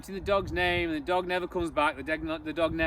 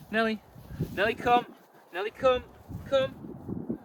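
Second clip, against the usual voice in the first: recall command; 4 semitones higher than usual. Shown as a demonstration. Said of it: "like so".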